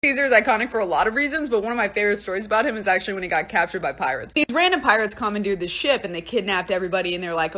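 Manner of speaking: usual speaking voice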